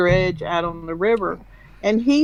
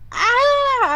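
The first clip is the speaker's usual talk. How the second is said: imitated big cat scream; 4 dB louder than usual. Shown as a demonstration. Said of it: "I can't do it 'cause I have a deep".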